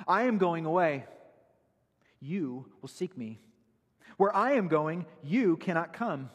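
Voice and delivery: impassioned preacher quoting Jesus; preaching at full force. Acted. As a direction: proclaim Jesus' words like thunder from the pulpit.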